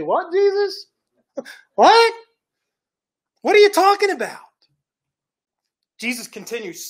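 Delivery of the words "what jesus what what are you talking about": shocked disbelief. Acted. Peter shocked at Jesus' words.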